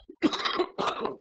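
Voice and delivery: sharp cough from a cold. Real, not acted. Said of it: "je suis un peu enrhumé".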